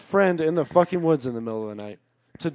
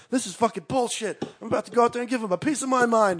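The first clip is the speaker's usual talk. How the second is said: mock anger; 7 semitones higher than usual. Cousin getting mad at the cops' leniency, mock anger. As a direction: wind up high and indignant, retelling him. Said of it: "my cousin started getting mad".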